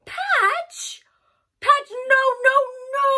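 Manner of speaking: very high, loud no; high, loud alarmed calls; alarmed shout. Acted. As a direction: panic for the teddy. Dog grabs teddy.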